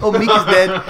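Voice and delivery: high, amused New Zealand impression; comic New Zealand lilt, close to laughing; terrible New Zealand accent. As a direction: badly imitate a New Zealander. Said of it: "I'm doing a terrible New Zealand accent".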